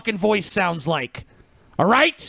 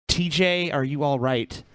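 rough, hoarse and low; croaky after a cough-filled week; 8 semitones lower, 4 dB quieter than usual. Real, not acted. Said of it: "what's wrong with your voice".

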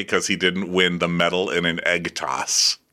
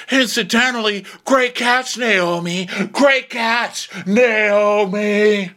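high, whiny taunting; mock sarcastic parting shot; 12 semitones higher, 4 dB louder, slower than usual. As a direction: call the jab after them, louder at the end.

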